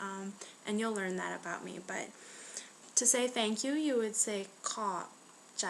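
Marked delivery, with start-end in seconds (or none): 4.6-5.7 slow, with a pause between the two words. Shown as a demonstration. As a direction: split "ขอบ" and "ใจ" for a learner. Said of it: "I said that slow".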